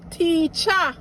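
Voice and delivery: "Teacher" with the final ER as an open A, teacha; Jamaican patois teacher. Shown as a demonstration. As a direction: drop the R and end "Teacher" on A. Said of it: "we replace that E R at the end".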